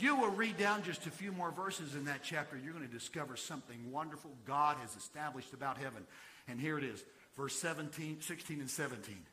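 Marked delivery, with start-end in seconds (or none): none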